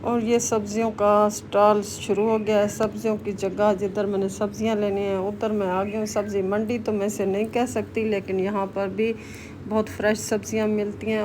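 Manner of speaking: usual speaking voice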